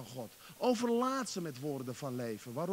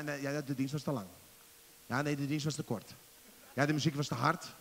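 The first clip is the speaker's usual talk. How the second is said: normal talking voice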